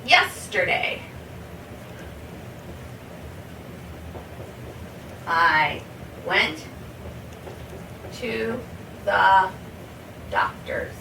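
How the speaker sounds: long pauses between every word; slow word by word. Shown as a demonstration. Teacher to student, slow model of the past tense. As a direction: spell the sentence out slowly. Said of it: "You could say".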